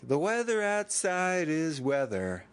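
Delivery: Paul Rudd. Retold or imitated. Quoting a funny movie character, slow mock delivery. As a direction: lightly imitate a laid-back movie line. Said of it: "Paul Rudd is so funny in that movie".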